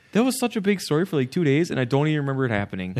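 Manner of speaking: high, fast retold disbelief; incredulous. Host retells his reaction to old news, quick incredulous voice. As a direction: rattle it off amazed it was forgotten. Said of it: "I was like".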